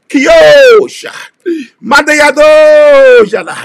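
ecstatic tongues shout. Real, not acted. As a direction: let the tongues burst out in ecstasy. Tongues burst mid-sermon.

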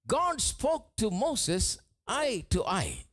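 drawn-out, deliberate preaching; slow emphasis. Real, not acted. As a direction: stretch each word to drive it home. Preacher stresses intimacy slowly.

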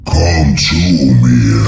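low, slow and loud demonic voice; a hungry demon luring her closer, slowly; spiteful inhuman voice. Acted. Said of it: "Es klang gehässig, verlangend und unmenschlich".

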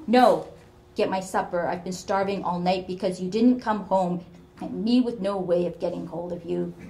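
put-on a sour old mother berating her child; bitter scolding.

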